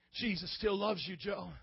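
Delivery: imitating a mother, low and softened; tender motherly assurance. Mother tells her drugged son he is loved, tender and quiet. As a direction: reassure gently, dropping away on the name.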